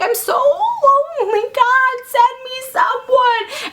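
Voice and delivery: high, drawn-out plaintive prayer; lonely blind man. Acted. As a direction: wail a hammed-up plea to heaven. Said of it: "this guy's, like, blind".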